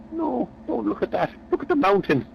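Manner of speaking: high, correcting voice; a touch of mock scolding; exasperated mate. [name] scolding Phil.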